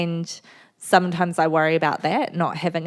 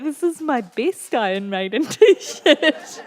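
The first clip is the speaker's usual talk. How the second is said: higher, slow, laughing repeat of the line; slow laughing quote; 8 semitones higher, slower than usual. Retold or imitated. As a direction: drag it out while laughing. Husband voiced again, slower, laughing.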